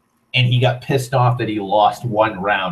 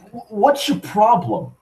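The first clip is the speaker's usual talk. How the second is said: put-on high, stammered drunk complaint; whiny protest; 10 semitones higher than usual. Drunk Mark challenging his girlfriend's refusal, whiny protest.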